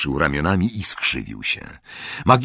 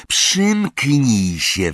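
slow, higher, drawn-out growled order; gruff snarl; 7 semitones higher, much slower than usual. A narrator's reading. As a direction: snarl it slowly to silence someone. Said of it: "warknął Moody". (powolne, gniewne warknięcie)

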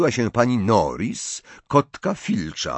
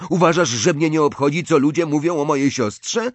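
raised, higher indignant question; heated quarrel; 7 semitones higher, 3 dB louder than usual. A narrator's reading. Ron defends his sister's reputation, heated. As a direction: push back hotly at your sister.

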